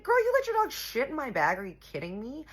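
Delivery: high, loud retort, easing at the end; indignant outburst. Retold or imitated. Storyteller replaying her comeback to the rival, indignant outburst. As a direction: confront her in disbelief, acting out the quarrel.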